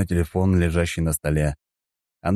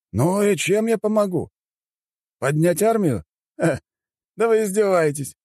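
high-pitched, put-upon official; dismissive chairman; 13 semitones higher than usual. Acted. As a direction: brush off the caller in a high voice. Bored chairman on the phone. (высокий пренебрежительный голос председателя)

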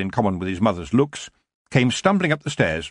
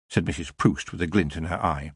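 ordinary delivery

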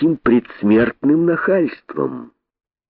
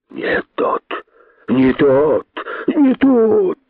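hissed chorus climbing from breath to high; hissing boas; 7 semitones higher, much slower than usual. A narrator's reading. Boas echoing their king, rising hisses. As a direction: start breathy, raise each repeat. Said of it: "зашипели удавы".

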